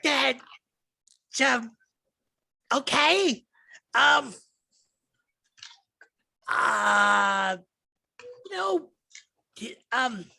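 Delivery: lightly voiced goblin girl at a loss; flustered goblin. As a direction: stammer like she was caught off guard. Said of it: "she goes".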